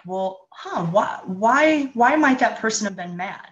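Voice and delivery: rising, wondering questions; mock puzzled musing; curious wondering. Shown as a demonstration. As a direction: muse aloud like puzzling it out. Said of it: "the example I did with the microphone".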